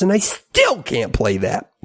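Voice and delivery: ordinary delivery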